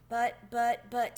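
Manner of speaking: stammering, lost for words; sputter, searching for an answer; helpless spluttering. A narrator's reading. Tucker Mouse unable to argue with Chester, spluttering. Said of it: "spluttering helplessly".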